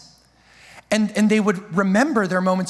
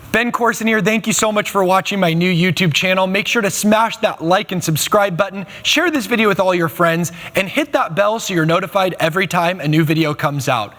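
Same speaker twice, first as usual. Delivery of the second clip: louder, upbeat YouTube sign-off; outro announcer read; 5 dB louder than usual. Acted. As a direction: push the like and subscribe like a host.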